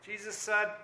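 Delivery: ordinary talk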